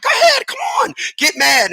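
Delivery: very high goading shout; egging on. Acted. Emotions urging each other, high goading shout. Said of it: "they be like".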